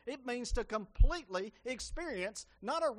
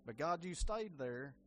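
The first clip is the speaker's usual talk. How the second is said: earnest spoken prayer to Jesus. Acted. Preacher leading the church in prayer, earnest.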